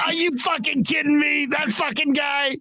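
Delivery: high-pitched incredulous exclamation; mock astonished protest; acted outraged disbelief. Colleague hearing about the voicemails, high incredulous impression. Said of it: "He's like".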